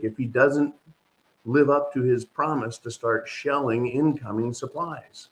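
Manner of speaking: normal talking voice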